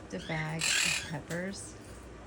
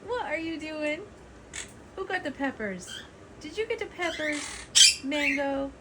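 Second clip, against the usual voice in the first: high teasing questions to the bird; high pet-talk voice; 8 semitones higher than usual. Real, not acted. Owner teasing her parrot about the peppers, high pet talk.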